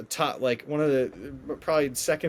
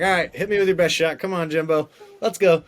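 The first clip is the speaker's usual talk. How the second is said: playful, raised-pitch bravado; cocky challenge; 3 semitones higher, 3 dB louder than usual. Retold or imitated. Contestant daring the host, cocky and playful. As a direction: egg him on, ready for the joke.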